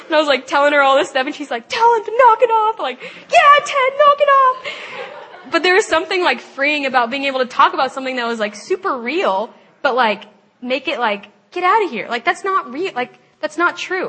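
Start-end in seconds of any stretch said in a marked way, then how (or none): 1.7-2.8 high, loud bossy order; mock indignant shout. Roommate orders Ted to stop. 3.0-5.5 very high, loud, drawn-out telling-off; mock defiant shout. Joins roommate yelling at Ted. 11.5-14.1 higher-pitched mock brush-off; playful dismissal. Acting out dismissing the lie.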